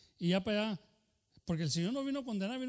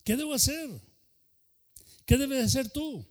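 raised, higher preaching challenge; loud rhetorical challenge; 6 semitones higher, 5 dB louder than usual. Real, not acted. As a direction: call out the question to the room.

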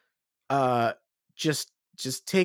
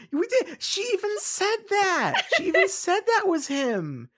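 high excited laughing squeal; 3 dB louder than usual. Real, not acted.

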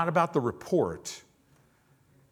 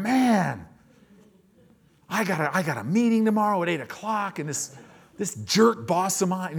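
mock complaining; 7 semitones higher than usual. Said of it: "you're already going".

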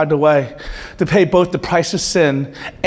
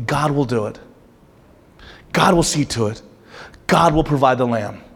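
soft, faithful assurance; quiet trust; 5 semitones lower, 3 dB quieter than usual. Retold or imitated. Preacher voicing Abraham's quiet trust, soft and steady. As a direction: say it gently, trusting God. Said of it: "what's giving him the ability to go up is".

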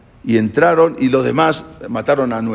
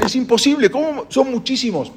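higher, alarmed disbelief; despairing crowd; 11 semitones higher than usual. Retold or imitated. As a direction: cry out that it can't be done. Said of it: "decían".

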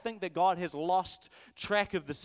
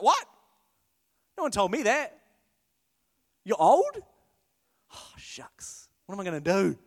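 flustered angel; 6 dB louder than usual. Retold or imitated. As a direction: caught off guard, rising panic. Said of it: "Imagine the angel's like".

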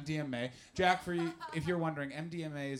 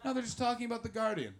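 mock phone heckler; 6 semitones higher than usual. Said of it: "Just like".

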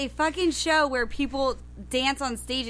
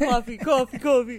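repeated word in New York accent; 4 dB louder than usual. Shown as a demonstration. Asked to do East Coast accent.

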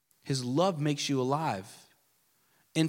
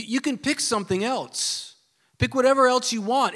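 higher and louder, pressing; emphatic challenge; 7 semitones higher, 3 dB louder than usual. Real, not acted. Preacher daring the congregation, raised voice. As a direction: throw the dare out to them.

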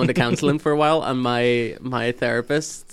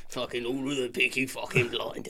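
Peaky Blinders Brummie; 2 semitones lower, 8 dB quieter than usual. Shown as a demonstration. Viewer copying Peaky Blinders, soft Brummie accent. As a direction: mutter it in mock Birmingham. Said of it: "when watching Peaky Blinders".

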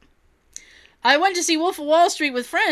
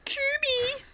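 squeaky echo; 13 semitones higher, 6 dB quieter than usual. Pretend. Echoing silly voice.

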